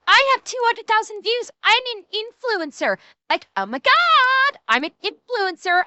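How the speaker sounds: high, bubbly mock influencer; ditzy Valley Girl impression. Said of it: "did I scare Dax with my Valley Girl".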